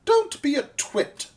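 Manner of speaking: high, loud lady brushing it off; light, high-pitched feminine scoff; lady's voice. A narrator's reading. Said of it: "the lady said".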